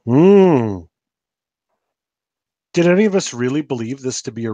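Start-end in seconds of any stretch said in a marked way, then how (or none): none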